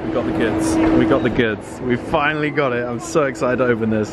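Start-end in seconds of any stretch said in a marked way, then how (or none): none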